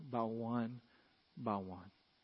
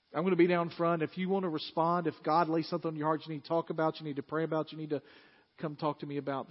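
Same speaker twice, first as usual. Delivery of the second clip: louder, urgent invitation; altar call; 2 semitones higher, 6 dB louder than usual. Acted. Pastor inviting people down front, urgent preaching. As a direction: call them forward with urgency.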